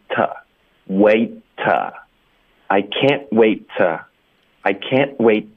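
slow "can't wait" with stopped t's and "to" reduced to "tuh"; weak linked "to". Shown as a demonstration. Teacher drilling linking, slow "can't wait" with clean stops. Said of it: "然后to我们会弱读成t".

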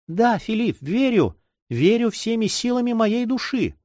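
put-on high, heartfelt girlish avowal; ardent young girl. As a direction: affirm it fervently as a girl in love. Girl fervently declares faith in lover.

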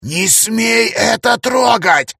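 high, slow menacing shout; sinister shout. Acted. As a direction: threaten at full voice. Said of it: "зашипел зловещим голосом". (зловещий крик)

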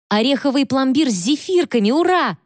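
high, excited squeal of delight; gleeful cheer. A narrator's reading. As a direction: cheer like a kid who got a treat. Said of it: "С торжествующим видом".

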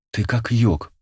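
lightly voiced admiring girl; a touch of girlish admiration. A narrator's reading. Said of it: "восхитилась Алена".

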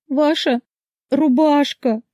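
very high tearful wail; hysterical crying. A narrator's reading. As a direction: squeeze it out through hysterics. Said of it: "сквозь истерику с трудом выдавила".

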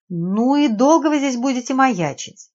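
exasperated scolding question; irritated. A narrator's reading. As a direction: nag impatiently at someone holding you up. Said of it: "раздражённо произнесла девица".

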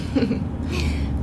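a quick amused laugh with a rise; short laugh. Real, not acted. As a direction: laugh it off lightly. Amused by the Thunderbird remark.

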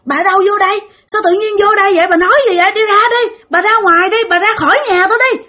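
high, alarmed protest; crying out. Acted. Big sister to old woman intruding in her house, crying out. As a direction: pitch right up, urgently ordering an intruder out. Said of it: "chị hai mới la lên".

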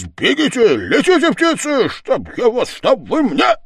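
very high, loud spluttering rage; furious tirade. Acted. Village head drives men out, shrieking fury. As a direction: rant at the top of the voice.

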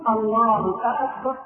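drawn-out "آكبر" as if asking; questioning hamza on أكبر. Shown as a demonstration. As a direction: stretch the first vowel of "أكبر" into "aakbar". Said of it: "إدخال همزة الاستفهام على لفظ أكبر". (مد همزة أكبر كالاستفهام)

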